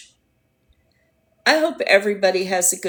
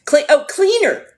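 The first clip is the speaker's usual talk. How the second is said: sudden realization; 7 semitones higher, 7 dB louder than usual. Real, not acted. Finally gets the chat's meaning.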